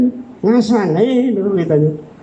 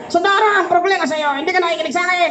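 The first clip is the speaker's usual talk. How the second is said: nagging wife voice; 6 semitones higher, much faster than usual. Retold or imitated. Wife's complaint quoted.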